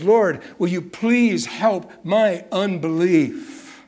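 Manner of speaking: scared father pleading with the Lord; desperate plea. Acted. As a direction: beg the Lord for help, slowly. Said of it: "concerned and scared to death".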